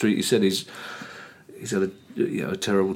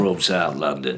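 imitating South London accent; 4 semitones lower, slower than usual. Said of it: "I love the way he tries to put a South London accent on".

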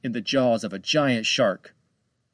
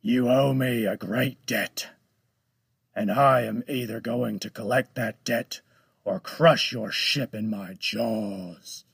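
menacing shark voice. Acted.

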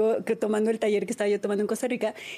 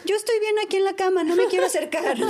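put-on higher, childlike refusal; scared little daughter; 7 semitones higher than usual. Daughter refusing to go near the spider, higher childlike voice. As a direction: play the frightened kid staying in bed.

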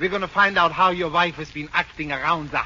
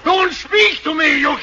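outraged shout; 11 semitones higher, 6 dB louder than usual. Acted. Wife refuses to be spoken to, outraged shout. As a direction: cut him off at full voice.

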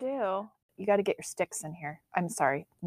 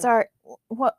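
yawning; 4 semitones higher, 7 dB louder than usual. Real, not acted. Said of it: "I just had a really big yawn".

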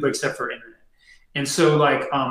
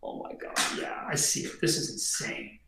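hushed, breath-only reply; awed whisper; 6 semitones lower, 14 dB quieter than usual. Acted. Tiny man answering the entity, awed whisper. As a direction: whisper it as if overwhelmed by a vision.

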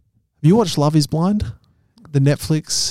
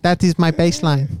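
put-on Chinese accent; 11 semitones higher than usual. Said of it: "doing an accent".

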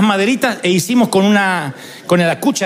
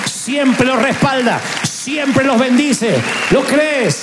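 higher and slow, each siempre laid down firmly; fervent reassurance; 5 semitones higher, much slower than usual. Real, not acted. Preacher assuring the congregation of God's care, slow and earnest. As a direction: promise it slowly to every listener.

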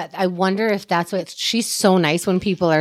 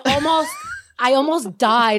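ordinary talk

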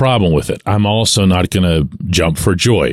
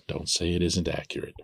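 much softer, under the breath; quiet repeat; 3 semitones lower, 15 dB quieter than usual. Real, not acted. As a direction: repeat it as a hushed aside. Repeats own remark under his breath.

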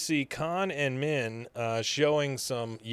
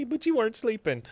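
much higher singsong imitation; teasing niece; 11 semitones higher than usual. Niece who walked in on the couple, high and teasing. Retelling Luann walking in on couple.

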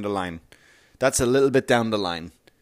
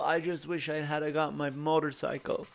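put-on much softer, frail old voice; dying grandpa; 2 semitones higher, 10 dB quieter than usual. Dying grandpa to the grandchild, very soft and frail. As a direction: breathe it out faintly.

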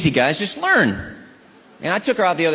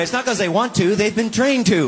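usual speaking voice

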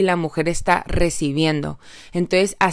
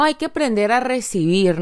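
usual speaking voice

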